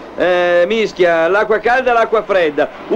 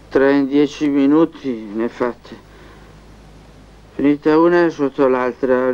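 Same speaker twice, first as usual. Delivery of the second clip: sleep talking; 7 semitones lower than usual. Acted. Talks to himself in sleep, low.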